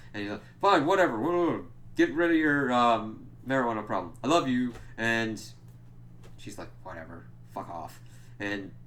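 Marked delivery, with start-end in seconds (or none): 0.6-4.7 loud, higher mock brush-off; dismissive placating. Dad brushing off his daughter's problem, loudly dismissive. Acting Randy brushing off Shelley. 6.8-8.0 low, soft imitated brush-off; sullen dismissal. Daughter answering her dad, quietly dismissive. Acting Shelley's sullen reply.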